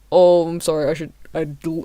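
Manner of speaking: ordinary talk, nothing marked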